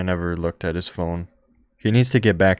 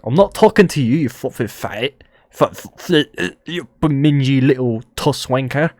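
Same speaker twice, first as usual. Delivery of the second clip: imitating an angry Scottish man, higher and louder; hammed-up Scottish insult, loud; Scottish accent, mock angry; mock Scottish rant; 5 semitones higher, 4 dB louder than usual. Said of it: "not used to the Scottish accent yet".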